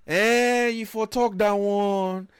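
high, drawn-out mock backpedalling; placating officer. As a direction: play the officer suddenly impressed, stretching words. Officer backing down, acted out.

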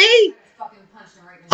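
loud outburst. Retold or imitated. Heated retelling.

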